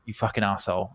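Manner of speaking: low, soft seething curse under the breath; muttered curse. Retold or imitated. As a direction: swear quietly, full of hidden rage. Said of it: "it's like".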